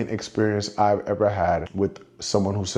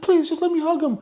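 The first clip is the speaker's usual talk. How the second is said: high-pitched imitation of a begging fan; pleading. Fan begging security for a hug, high pleading imitation.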